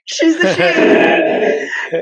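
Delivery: very slow, drawn out, nearly shouted; emphatic punchline. Real, not acted. Storyteller stressing the first human was female, emphatic. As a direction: land each word with triumph.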